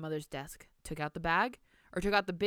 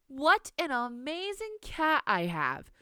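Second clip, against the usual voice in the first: sarcastic; 9 semitones higher, slower than usual. Acted. Grandmother mocks the girl's lie.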